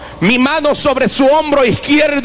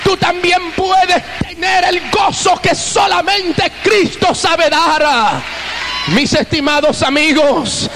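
fervent revival preaching; full-force preaching; 8 semitones higher, slower than usual. Acted. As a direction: preach it to the whole congregation.

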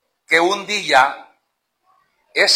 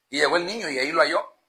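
ordinary talk, nothing marked

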